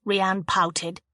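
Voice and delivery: ordinary delivery, nothing marked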